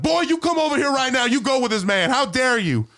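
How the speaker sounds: high-pitched mock telling-off; acted indignant scolding. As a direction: play a scandalized guard ordering a kid over. Guard scolds the kid.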